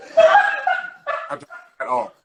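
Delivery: squeaky high mocking squeal; laughing high-pitched mockery; mock uptalk. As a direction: parody the rising voice with a laugh.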